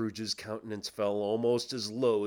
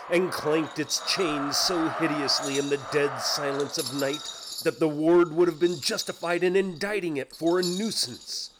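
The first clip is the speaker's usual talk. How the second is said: ordinary delivery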